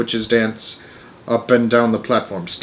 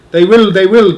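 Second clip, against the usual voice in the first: louder and higher, eager witches; eager crowd cry; 8 semitones higher, 8 dB louder than usual. Acted. Witches eagerly answering their leader, louder and higher. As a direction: cheer her on like a crowd of witches. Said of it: "cried the audience".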